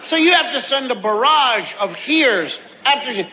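ordinary talk

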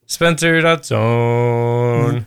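slow deadpan. Acted. Deadpan repeat of catchphrase.

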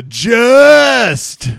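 big, loud, drawn-out JUST; morning DJ. Shown as a demonstration. Co-host showing how he says just, morning DJ blare. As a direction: belt out "just" like a radio jock. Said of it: "say it like a morning D J".

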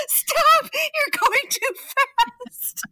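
high, drawn-out panicked wail; freaking out. Retold or imitated. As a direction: plead with the driver in hammed-up panic. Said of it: "I'm still freaking out".

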